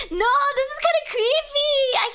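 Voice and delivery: high, loud squeal of unease; real giggly fright; creeped-out whine. Real, not acted. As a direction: protest in a high, spooked squeal. Creeped out after reading aloud.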